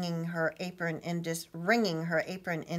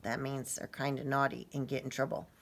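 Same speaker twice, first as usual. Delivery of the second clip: ordinary delivery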